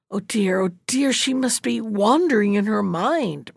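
plain reading voice

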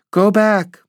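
very high, small warning cry. A narrator's reading. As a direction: send out a high-pitched call of alarm. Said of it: "they cried".

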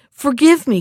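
a captured prince crying out to the witch for mercy; desperate plea. A narrator's reading. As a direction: beg for his life, voice rising. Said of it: "cried the prince".

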